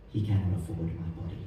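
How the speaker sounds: quiet poem reading. Acted. Poet reading a protest poem to the audience, quiet and controlled. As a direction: lay out each hard fact softly and evenly.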